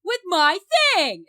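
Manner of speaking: slow, high, loud mock shout; hammed-up angry yell; James Remar yelling. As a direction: drag out the bellow in exaggerated outrage. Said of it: "he's just yelling at them".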